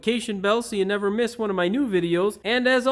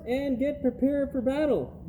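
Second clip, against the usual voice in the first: normal talking voice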